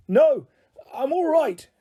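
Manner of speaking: high, breezy call up from below; shouting. Acted. Grandpa Sam shouting up to the helicopter, unbothered. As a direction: wave them off with a shout. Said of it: "shouted Grandpa Sam".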